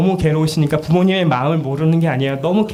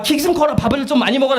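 higher, pushy motherly nag; urging mother; 10 semitones higher than usual. Retold or imitated. Mother urging a short child to eat, mock nag. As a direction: press the kid to eat more.

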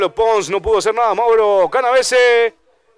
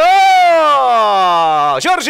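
surprised exclamation; 3 semitones higher, 4 dB louder, much slower than usual. Real, not acted. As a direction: exclaim the save. Shot saved, commentator exclaims.

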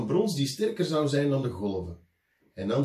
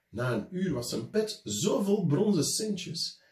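ordinary delivery

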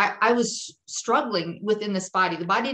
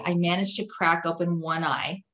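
normal talking voice

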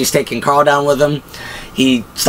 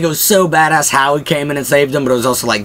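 ordinary talk

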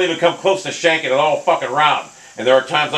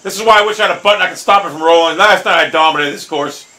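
ordinary talk, nothing marked

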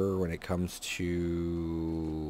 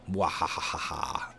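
mock evil laugh; 4 semitones lower, 4 dB quieter, slower than usual. Evil laugh after plan.

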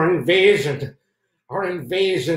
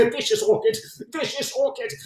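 startled yelp; 10 semitones higher than usual. Acted. Startled by jungle flower.

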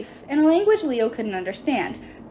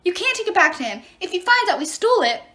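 alarmed warning; 8 semitones higher than usual. Acted. Frightened dwarf warns his captors.

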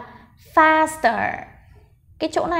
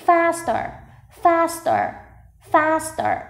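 "faster" as FAS-ter, first syllable high; stress on first syllable; 4 semitones higher, much slower than usual. Shown as a demonstration. Teacher drilling word stress, FAS-ter with high first syllable. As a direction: punch FAS in "faster", let -ter drop. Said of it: "mình sẽ đọc to âm đầu tiên".

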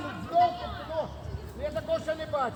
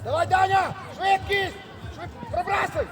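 urging shout; 5 semitones higher than usual. Real, not acted. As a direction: yell a player forward at full speed. Coach urges player on during attack.

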